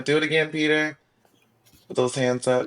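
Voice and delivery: high, loud playful cheer; excited cheering. Real, not acted. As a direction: egg him on loudly. Cheering Peter to dance.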